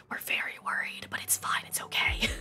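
hushed, voiceless whisper; whispered worry. Pretend. Streamer admitting the truth after reassuring chat, whispered. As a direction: whisper it like a secret aside.

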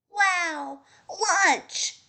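high, excited storybook cry; excited shout. A narrator's reading. Pecan spots a nut to eat, excited shout. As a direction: cry out at the sight of lunch. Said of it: "Pecan shouted".

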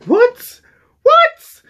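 very high, loud rising what; can't believe they won; shocked disbelief. Retold or imitated. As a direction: shriek in disbelief. Disbelief at winning.

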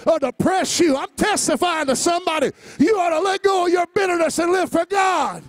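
high, loud shouted preaching; rising fiery sermon shout; preaching at full force. Acted. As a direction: preach it out to the whole church. Preacher exhorting congregation.

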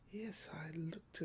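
soft breathy whisper; quiet intimate whisper. A narrator's reading. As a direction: whisper it as you stare. Hushed close look.

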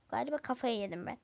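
ordinary talk, nothing marked

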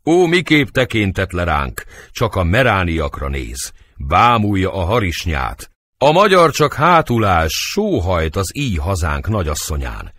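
normal talking voice

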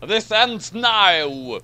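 very slow, loud hero vow; slow dramatic declaration. Acted. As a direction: drag out each word with grim resolve. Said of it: "he declared".

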